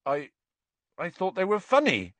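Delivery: high, hesitant halting start; falter, then offer it unsurely; uncertain. A narrator's reading. Hagrid defending his book choice to Hermione, uncertain. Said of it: "Hagrid said uncertainly to Hermione".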